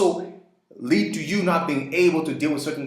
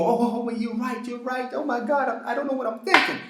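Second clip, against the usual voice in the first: much higher, squeaky fluster, quicker at the end; mock spineless caving; 10 semitones higher than usual. The man caving to critics of his plan, high mocking fluster. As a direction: play a flustered pushover folding at once.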